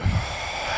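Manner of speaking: long, audible exhale; deep calming breath. Shown as a demonstration. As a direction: breathe the nerves out slowly. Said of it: "Yeah, deep breaths".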